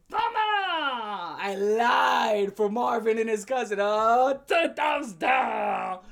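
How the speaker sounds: a goofy loud farewell bit; hammed-up outro shout. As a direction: shout the sign-off as a joke. Jokey outro shouting thumbs.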